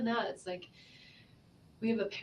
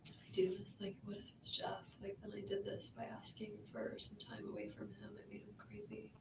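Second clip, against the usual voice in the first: hushed whisper; 7 dB quieter than usual. Real, not acted. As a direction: whisper it, barely voiced.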